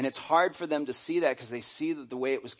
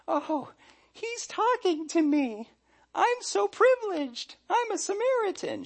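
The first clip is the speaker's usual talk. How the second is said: mock-grateful timid woman, much higher; mousy, meek woman. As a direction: play her as a meek mouse, awed and small. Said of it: "her response was very mousy, very meek".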